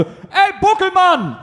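very high-pitched mock street yell; imitated call-out. Courier hailing customer from the street, imitated high call-out. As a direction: holler it like someone outside a window.

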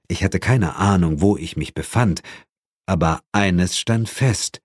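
usual speaking voice

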